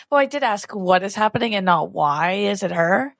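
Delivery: ordinary talk, nothing marked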